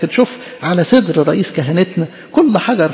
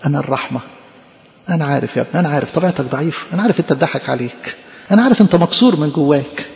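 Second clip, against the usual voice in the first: tender compassion; 4 semitones lower than usual. Acted. Christ answering a weak believer, tender compassion.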